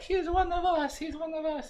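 very high, chanting mock soldiers; high mock voices. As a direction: squeal it like excited soldiers. Mock soldiers recognizing him.

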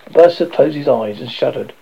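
ordinary delivery

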